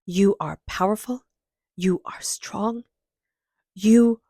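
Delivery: soothing guided meditation read. Acted. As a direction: gently lead listeners to hug their inner child. Guided inner child meditation.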